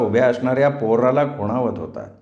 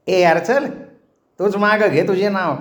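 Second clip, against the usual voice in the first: defiant retort; 7 semitones higher than usual. A narrator's reading. Boatman rudely answers the shouted warning.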